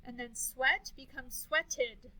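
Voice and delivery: slow, sweated ending in a clear "id"; ed ending shown. Shown as a demonstration. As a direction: draw out sweated so the ending is heard. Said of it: "Did you hear the did sound?".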